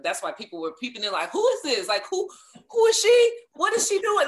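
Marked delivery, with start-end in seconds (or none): 1.3-4.3 high, loud mock astonishment; exaggerated incredulous questioning; imitated nosy onlookers. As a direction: play baffled gawkers, building louder and higher. Voices puzzled onlookers peeping in.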